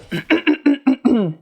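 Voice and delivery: real throat clear. Real, not acted. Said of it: "ça n'arrête pas de".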